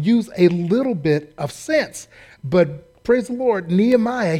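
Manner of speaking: normal talking voice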